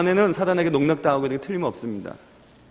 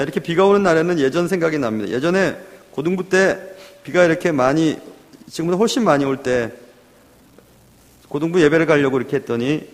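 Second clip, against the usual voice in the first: ordinary talk, nothing marked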